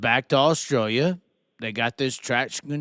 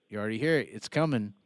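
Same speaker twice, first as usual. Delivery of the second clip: normal talking voice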